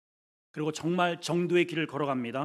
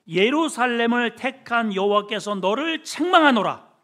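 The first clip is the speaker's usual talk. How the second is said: loud, raised full proclamation; forceful scripture; 5 semitones higher, 8 dB louder than usual. Acted. Preacher repeating the verse to the church, booming. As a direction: declaim it from the pulpit.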